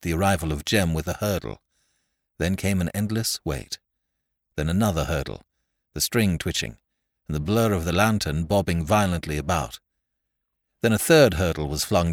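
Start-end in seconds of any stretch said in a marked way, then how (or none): none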